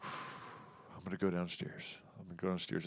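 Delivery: muttered to self. Retold or imitated. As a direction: mutter it under your breath. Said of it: "I've gotta go like this".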